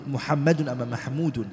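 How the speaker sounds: ordinary delivery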